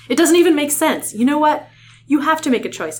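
high, loud scolding, acted out; angry. Rachel demanding Tobias choose human or hawk, angry. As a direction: lay down an angry ultimatum. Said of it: "Rachel said angrily".

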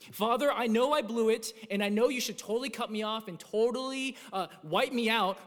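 loud, urgent plea to the Father; pleading prayer. Acted. Sinner begging God for mercy, loud and pleading. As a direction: cry out to God like a guilty child begging.

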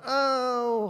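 sudden high startled oh; God halting the scientist, mock startled; mock surprise. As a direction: cut in with a jolt of surprise. God interrupts the boasting scientist.

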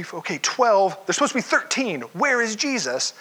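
usual speaking voice

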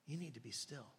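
soft, unhurried counsel; calm older lady. Retold or imitated. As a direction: answer quietly and patiently. Calm lady answers loud demand.

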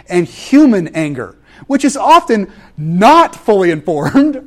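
normal talking voice

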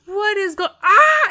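startled cry leaping into a high shriek; terrified scream. Acted. Farmhand spotting a bear, screaming in terror. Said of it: "screams like he's just terrified".

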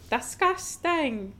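slow, high prissy disgust; prim little lady. Pretend. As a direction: play the innocent little lady. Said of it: "I'm innocent little lady".

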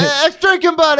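put-on high, loud, bright coworker hello, no accent; excited coworker greeting. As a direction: call it out across the kitchen, way up high. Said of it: "I love how politically correct that was".

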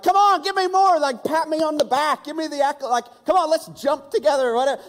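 very high, loud, needy begging; pleading for praise. Retold or imitated. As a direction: demand a pat on the back, overexcited.

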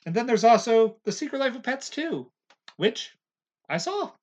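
high, loud eager build-up; excited. Real, not acted. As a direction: announce it with a burst of excitement. Excited about a movie mention.

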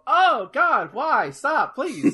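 mock horrified plea. Reacting to a brutal Mortal Kombat fatality, mock horrified plea. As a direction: beg it to stop in playful horror. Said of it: "you go".

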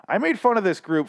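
blurting it out loud and high; too loud. Real, not acted. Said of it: "I'm sorry if I blew up the mic there".

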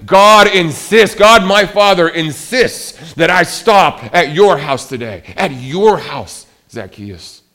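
loud, slow, high proclamation of God's will; insistent divine command. Acted. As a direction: declare it with full force. Preacher voicing Jesus, insistent.

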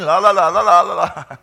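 put-on sing-song la-la like a kid covering his ears; mock refusing to listen. Preacher acting his touchy morning self tuning out his wife, mock childish la-la. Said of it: "I'm like going".

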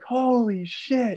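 high, drawn-out mock scream; retold scream. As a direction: re-enact screaming at a bear, stretching each word. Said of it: "I just screamed".

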